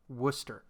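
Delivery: Worcester said as two syllables, WUSS-ter; local pronunciation. Shown as a demonstration. Co-host showing how locals pronounce the town, local pronunciation. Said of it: "they pronounce it".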